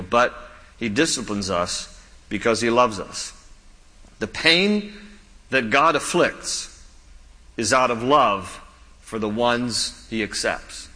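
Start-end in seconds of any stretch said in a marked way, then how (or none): 4.2-10.9 slow, weighty delivery; slow emphatic. Shown as a demonstration. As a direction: lean on each phrase slowly. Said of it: "Let me say it again".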